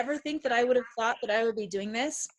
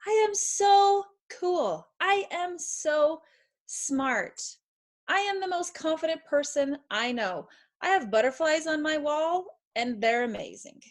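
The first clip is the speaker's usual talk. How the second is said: higher, bright-voiced playful bragging; mock boastful; 6 semitones higher than usual. Mother playfully voicing a confident kid, higher and boastful. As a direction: ham it up like an over-confident kid. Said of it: "most powerful, believable voice to say".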